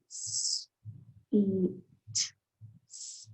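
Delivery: sweets segmented into s, e, t, s, whispered; sounding out words. Shown as a demonstration. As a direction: say each sound of sweets apart, quietly. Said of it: "we can sound out sweets".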